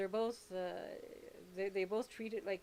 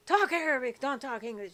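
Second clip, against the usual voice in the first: imitating father yelling; 3 semitones higher, 9 dB louder than usual. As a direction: bark it like her angry dad. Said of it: "my father would yell at me".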